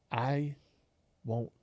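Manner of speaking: each word dragged out with long pauses; drawn-out demand. Retold or imitated. Customer spacing out his demand word by word. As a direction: spell it out slowly like a stubborn customer. Man slowly demands breakfast, spaced words.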